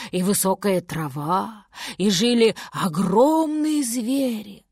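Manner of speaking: slow, hushed tale of long ago; musing elder recounting a legend slowly; old walrus storyteller. Acted. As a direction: draw out the words in wonder, dropping to near whisper.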